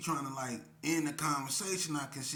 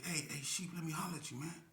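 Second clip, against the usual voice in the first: soft, hushed words to a friend; a gang member quietly pulling a friend aside; 2 semitones higher, 6 dB quieter than usual. Retold or imitated. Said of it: "He said".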